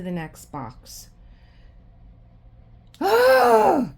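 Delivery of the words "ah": sudden high loud cry of frustration; real scream of annoyance at the canvas; frustrated scream. Real, not acted. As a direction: let out an exasperated yell. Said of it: "I'm so aggravated with these new canvases".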